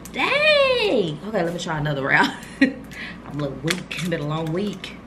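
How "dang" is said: very high, stretched-out "dang"; wowed. Real, not acted. Smelling Ambrosia, she's floored by it. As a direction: let it ring out amazed.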